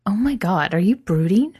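bright mock alarm; teasing surprise. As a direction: tease him with sudden alarm. Adam teasing Chris.

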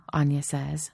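ordinary delivery

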